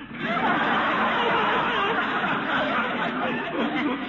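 long, high, rolling delighted laugh; gleeful laugh. Acted. Dreaming of the houseboat, high gleeful laugh. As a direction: savour the dream with a big laugh.

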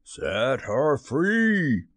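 slow, drawn-out captain's command; commanding put-on captain voice; Hook imitation. A narrator's reading. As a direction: order it like a pirate captain, words stretched long. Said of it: "imitated the voice of Hook".

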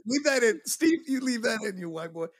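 ribbing a friend over his slip, playful; teasing. Real, not acted. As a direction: needle him about the mistake. Teasing co-host over blunder.

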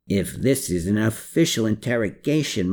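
ordinary delivery, nothing marked

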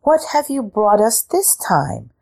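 a hint of sly cat queen. A narrator's reading. Cat queen questions Ivan.

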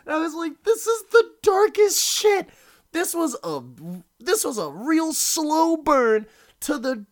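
high-pitched, loud horrified outburst; worked-up, high cry of horror. Retold or imitated. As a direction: wail it in appalled disbelief. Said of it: "staring at him horrified".

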